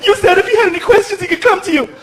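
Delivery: high mock female voice; scolding mother. Girlfriend reminds stepdad of promise, scolding high voice. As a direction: imitate an indignant mom.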